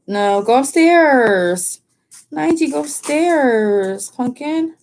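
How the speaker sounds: high, slow sing-song coaxing; drawn out and sweet, like calling a puppy; pet talk to dog. Real, not acted. Owner coaxing her dog Nige upstairs, high sing-song.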